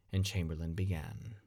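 plain reading voice

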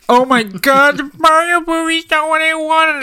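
very high-pitched mock wail; whiny upset fan. Podcaster mocking adult fans of a kids' movie, whiny high wail. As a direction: cry out in exaggerated, squealing disappointment.

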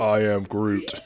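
lightly voiced movie-giant catchphrase; slight put-on creature voice; imitating Groot. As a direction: quote it as a mock gentle giant. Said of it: "sounds more like Vin Diesel".

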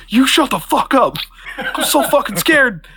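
high-pitched mock panic, half laughing; Will Ferrell. Game master joins in the comedian bit, hammed-up fear. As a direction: squeal it comically through laughter. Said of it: "This is absolutely Will Ferrell going".

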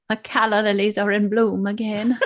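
Stage Door line, laughing through it; old Hollywood quote, half laughing; Katharine Hepburn voice. Pretend. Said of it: "is it Stage Door with the".

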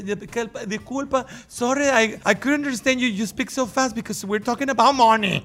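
flustered apology. Retold or imitated. Learner pleading with fast-talking clerk, flustered and high.

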